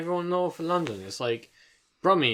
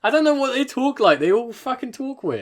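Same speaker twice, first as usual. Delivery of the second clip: high, louder complaint; frustrated outburst; 9 semitones higher, 4 dB louder than usual. Real, not acted. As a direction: throw up your hands.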